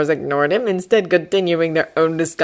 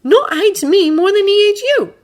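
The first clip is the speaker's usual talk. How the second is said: put-on high, loud haughty boy voice; Draco voice; 6 dB louder than usual. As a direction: promise it with a sneer.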